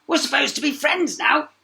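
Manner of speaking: high, loud frog; frog calling. A narrator's reading. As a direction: call out as the pleading frog. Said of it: "called the frog".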